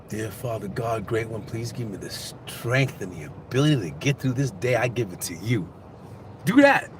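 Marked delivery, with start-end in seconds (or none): 0.1-5.7 soft, low murmured prayer; hushed, humble plea to God; quiet prayer. Shown as a demonstration. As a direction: pray privately under your breath. Demonstrates a short morning prayer.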